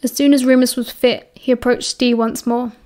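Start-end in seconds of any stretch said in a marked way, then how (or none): none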